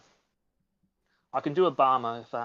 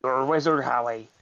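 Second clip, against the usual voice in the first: Hagrid impression; 3 semitones lower than usual. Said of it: "can you do a Hagrid".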